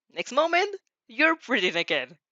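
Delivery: ordinary delivery, nothing marked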